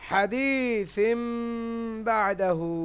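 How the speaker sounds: "হাদিসিম বাদাহু" slowly with a long hummed m; "হাদিসিম বাদাহু", n joined as a nasal m; iqlab recitation. Shown as a demonstration. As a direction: hold the m before "বাদাহু", stretch the long vowels in "হাদিসিম বাদাহু". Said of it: "বা যেমনই মেলাবেন".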